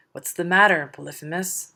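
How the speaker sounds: a sudden loud call from outside; yelled. Acted. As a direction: shout the question from afar. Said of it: "they yelled".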